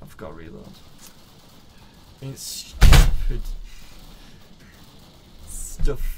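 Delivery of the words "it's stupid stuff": strained loud shout. Acted. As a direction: yell it out. Yelling in game.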